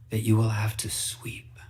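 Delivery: soft unvoiced whisper; hushed whisper. A narrator's reading. Fox telling reader about Cinderella's sadness, whispering. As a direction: whisper it like a secret sorrow.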